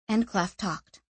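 ordinary delivery

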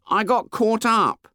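put-on lightly high, plaintive boy; a stuck boy explains, high and slow; high, slow boy's voice. A narrator's reading. Fat boy explains to Ralph, high and plaintive. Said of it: "the voice said".

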